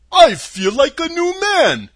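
loud, high, slow happy cry; a freed scarecrow exulting; joyful shout. Acted.

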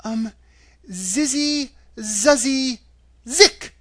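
put-on high girl's voice, hesitant incantation; halting, high recitation of a charm; Dorothy. A narrator's reading. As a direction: sound out magic words one by one, pitched high. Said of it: "said Dorothy".

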